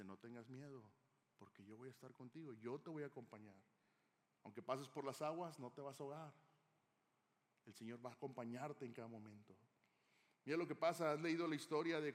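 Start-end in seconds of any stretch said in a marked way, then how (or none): 0.0-9.5 much softer, tender promise of comfort; gentle reassurance. Acted. God promising a frightened believer he is never alone, soft and tender. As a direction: ease a frightened listener's fear gently.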